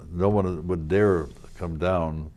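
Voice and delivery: normal talking voice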